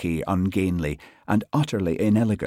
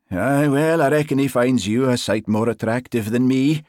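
loud, higher Highland banter; Highland accent; 6 semitones higher, 6 dB louder than usual. A narrator's reading. Sergeant joking about his dog's affection, Highland accent. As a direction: tease her in a broad Highland accent. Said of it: "His Highland accent always grew more pronounced when he was flustered".

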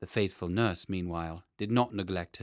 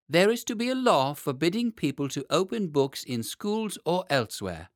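put-on higher, proclaiming like a boy king; royal decree; 7 semitones higher, 4 dB louder than usual. A narrator's reading. Boy king on his throne, proclaiming a law. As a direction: announce the law grandly.

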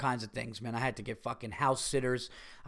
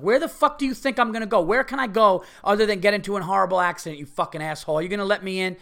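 yelling furiously at a driver who won't let him in, higher and louder; retold road rage yell; 6 semitones higher, 6 dB louder, faster than usual. Driver cut off in traffic, screaming at the other car. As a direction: blast it like you're stuck merging next to him. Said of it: "I was just like".

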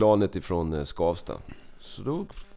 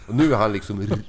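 ordinary talk, nothing marked

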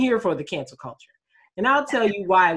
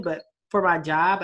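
ordinary talk

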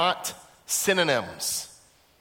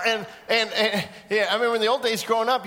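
usual speaking voice

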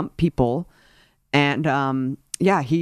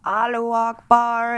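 mocking quote; 8 semitones higher than usual. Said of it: "hears that someone said".

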